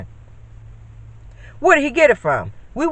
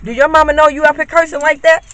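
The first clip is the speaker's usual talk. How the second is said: scolding neighbor; 7 semitones higher, 5 dB louder than usual. Retold or imitated. Neighbor calls out to kids walking home, loud scolding. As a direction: call out to stop the cursing kids.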